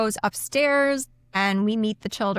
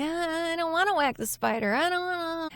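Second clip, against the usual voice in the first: put-on whining child; 8 semitones higher, 3 dB quieter than usual. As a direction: imitate a scared kid refusing. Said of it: "Michael's just kinda like".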